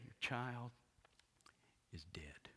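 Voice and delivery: soft and gentle, fading into a whisper; sweetly. Shown as a demonstration. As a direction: break the news tenderly, barely voiced. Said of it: "you sweetly say".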